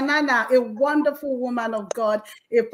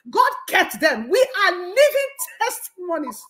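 raised, ringing preacher's delivery; impassioned testimony; 4 semitones higher, 3 dB louder than usual. Real, not acted. Testifying that God kept them through the wilderness, impassioned. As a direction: build in pitch as you testify to God's works.

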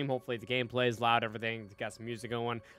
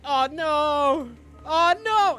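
mock panic; 13 semitones higher, 12 dB louder, much slower than usual. Lamb about to be sacrificed.

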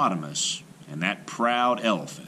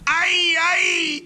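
high shouted gasps of strain; gasping cry; 7 dB louder than usual. A narrator's reading. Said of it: "gasped Hippopotamus".

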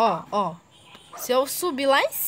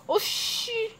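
surprised; 10 semitones higher than usual. Real, not acted. Surprised exclamation.

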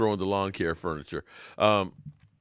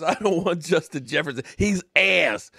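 quoting a fan's blunt verdict, pitched up; higher-pitched mock fan rant, punching the last word; Philly five hundred; 5 semitones higher than usual. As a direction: mimic the dismissive fan. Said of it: "when Philly five hundred says".

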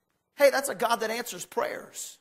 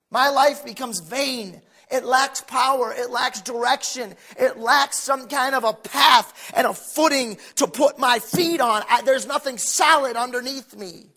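forceful preaching; 6 dB louder than usual. Real, not acted.

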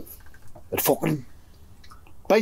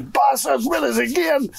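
high, imitated cry after the second bite; retold angry yell; 10 semitones higher than usual. Friend bitten again, retold.

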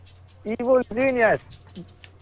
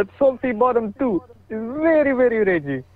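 higher-pitched mock-Arabic accent; put-on foreign accent; 5 semitones higher than usual. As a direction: play it up in a joke Arabic accent. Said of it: "You do that accent well".

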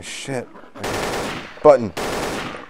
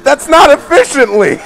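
indignant outburst; 7 semitones higher than usual. Real, not acted. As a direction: snap back in outrage. Heated banter argument.

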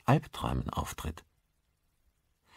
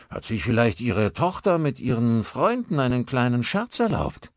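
higher-pitched character voice, lifting into a question; Maguire the installer, suggesting; 7 semitones higher, 4 dB louder than usual. Acted. Installer asks father about his daughter's prank, high-pitched suggestion.

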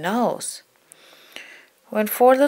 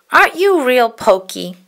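high, loud grumpy puppet voice; a hint of grouchy Oscar; grouchy question; 4 semitones higher, 6 dB louder than usual. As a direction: challenge him in a higher, louder voice.

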